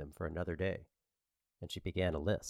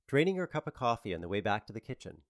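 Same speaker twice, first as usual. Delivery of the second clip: ordinary delivery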